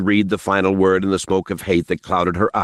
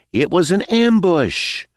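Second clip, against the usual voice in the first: smug high voice; 11 semitones higher than usual. Acted. Smiley's smug correction.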